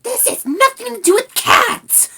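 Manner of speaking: very high, loud angry rat; outraged little rat; angry hiss. A narrator's reading. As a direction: snap back furiously in a tiny high voice. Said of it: "hissed Rat angrily".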